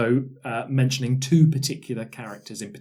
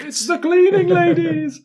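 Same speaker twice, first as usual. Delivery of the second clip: mock dramatic reveal; 6 dB louder than usual. Dramatic villain reveal joke.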